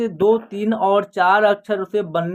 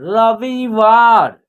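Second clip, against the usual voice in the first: very slow, each syllable apart; slow clear word demo; 4 dB louder, much slower than usual. Shown as a demonstration. Teacher modelling a new word for children, very slow. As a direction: drag the word out for beginners. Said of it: "और व में छोटी की मात्रा वी".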